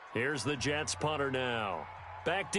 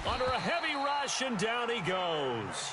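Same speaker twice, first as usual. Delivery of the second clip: excited play-by-play; 6 semitones higher than usual. Real, not acted. Commentator calls a sack as it happens, excited. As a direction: call the big play, stretching the ending.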